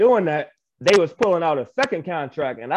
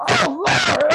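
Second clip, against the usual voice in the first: much higher and louder, near a shout; overwhelmed cry; 4 dB louder than usual. Retold or imitated. Seeing his own contract shown, overwhelmed high cry. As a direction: break out with joy and disbelief. Sees contract, emotions flood.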